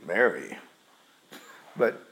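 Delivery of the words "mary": low, dismissive Mary; cold disdainful name. Shown as a demonstration. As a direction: say the name with contempt. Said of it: "like with disdain".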